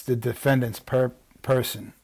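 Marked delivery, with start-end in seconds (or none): none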